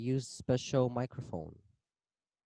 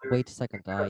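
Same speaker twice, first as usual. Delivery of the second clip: loud; 11 dB louder, faster than usual. Real, not acted. Fixing his microphone, loud.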